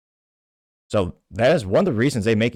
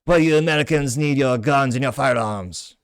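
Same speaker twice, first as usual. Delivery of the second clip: higher, slow, drawn-out mock French accent; bad French accent; 4 semitones higher, slower than usual. Sneering European mocking Americans about guns, in a bad French accent. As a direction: put on a hammy European accent, badly faked as French. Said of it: "Bad French accent, but the attempt was made".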